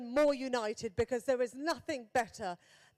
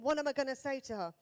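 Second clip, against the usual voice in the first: ordinary talk, nothing marked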